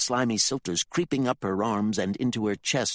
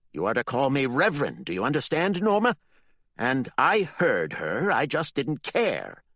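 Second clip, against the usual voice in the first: stern Reverend; 7 semitones higher, 4 dB louder than usual. Acted. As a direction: correct her like a stern boss. The Reverend scolds Norma.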